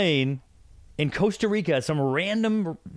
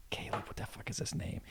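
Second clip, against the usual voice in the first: whisper; 15 dB quieter than usual. Real, not acted. Muttering to himself, forgot name.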